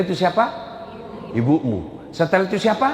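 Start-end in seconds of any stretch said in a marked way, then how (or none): 1.3-2.1 low, grave reply; solemn answer. Acted. Preacher voicing the Prophet's answer, low and grave. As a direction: answer weightily, lower.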